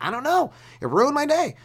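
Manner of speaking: high-pitched mock complaint; exasperated complainer. Comedian imitating someone moaning about a bad day, exasperated.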